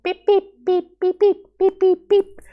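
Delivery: high mechanical beep beep; robot beeps. Retold or imitated. Teacher mimicking a machine's robotic beeping.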